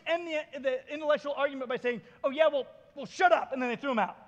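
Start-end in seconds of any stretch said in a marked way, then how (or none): 2.2-3.5 loud, higher-pitched sore-loser retort; mock frustrated comeback. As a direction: lose the argument and blurt it, playing mad. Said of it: "you're all frustrated and you're all mad".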